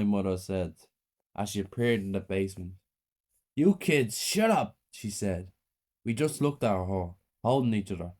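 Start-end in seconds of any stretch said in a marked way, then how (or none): 3.5-4.8 high, harsh order to shut up; angry snap. A narrator's reading. Mother to her children, angry order. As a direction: cut them off sharply.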